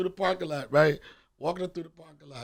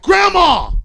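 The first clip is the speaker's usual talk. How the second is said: loud high shout of Grandma; shouted name; 14 semitones higher, 19 dB louder than usual. Retold or imitated. Holly yelling at grandma, loud shout. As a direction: yell it out in alarm. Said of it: "Holly like".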